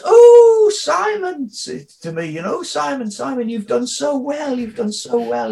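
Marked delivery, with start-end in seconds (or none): 0.0-1.5 much higher, louder gushing "Oh, Simon"; gushing teacher. Retold or imitated. Old school teacher greeting a former pupil, gushing, much higher. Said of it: "And she says". 2.6-5.5 gushing teacher. Retold or imitated. Teacher praising former pupil in sandwich queue, higher cooing. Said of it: "And she says".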